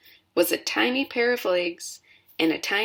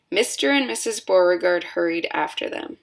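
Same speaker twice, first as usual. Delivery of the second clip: plain reading voice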